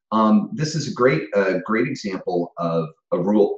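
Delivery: ordinary delivery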